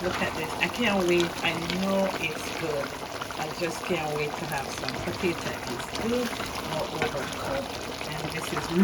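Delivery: ordinary delivery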